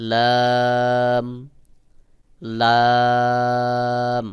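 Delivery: sustained level-note Arabic লাম; open-mouthed লাম. Shown as a demonstration. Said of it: "স্বাভাবিকভাবে মুখ খুলে".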